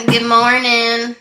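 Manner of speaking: slow, loud sing-song good morning; big slow morning greeting; drawn-out greeting. Real, not acted. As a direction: stretch it out like a cheery hello.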